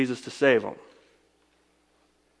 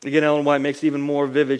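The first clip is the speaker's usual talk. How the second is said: ordinary talk, nothing marked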